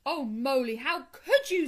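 loud, rising dismay; dismayed reproach. A narrator's reading. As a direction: reproach a friend who blundered. Rat reproaches mole.

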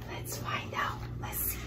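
soft secretive whisper. Acted. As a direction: hush them in for the secret. Building suspense for children.

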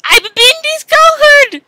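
high, loud melodramatic cry; hammed-up dismay. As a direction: wail like an exposed villain. Dramatic exposed cry.

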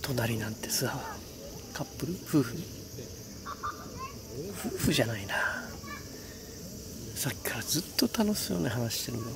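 soft, half-whispered night talk; hushed voice. Real, not acted. 夜のキャンプ場、隣に客. (ひそひそ声)

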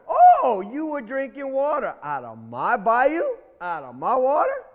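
high, loud, slow outraged alligator; indignant alligator. Acted. Alligator scolding the rabbit over his bayou, indignant. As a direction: puff up offended.

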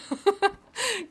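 high giggle; real bubbly giggle; amused laugh. Real, not acted. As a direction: break into a high, tickled laugh. Laughs about the unique name.

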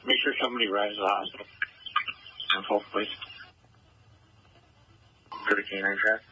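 crackly radio transmission; clipped call over a police radio; terse radio update. Real, not acted. Field unit over police radio.

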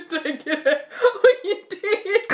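laughing through the words. Real, not acted. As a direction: talk while cracking up. Laughing during candy challenge.

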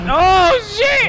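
high loud "Oh shit!"; startled. Real, not acted. Gamer startled mid-match, shouts out. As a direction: jolt at the surprise.

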